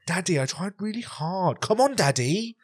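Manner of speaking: put-on high, pleading child voice; whiny posh kid. Imitated kid begging his dad, high posh whine. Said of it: "Like, that's how he was talking".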